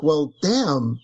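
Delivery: ordinary talk, nothing marked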